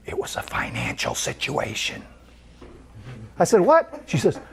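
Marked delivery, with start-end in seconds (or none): 0.1-2.0 low hushed confiding murmur; very quiet. Retold or imitated. Waitress quietly confiding to the pastor. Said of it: "she got really quiet and she looked around". 3.6-4.0 high incredulous yelp; shocked what. Retold or imitated. Pastor stunned by the waitress's news.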